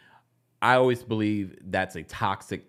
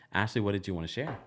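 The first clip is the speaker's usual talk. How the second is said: ordinary talk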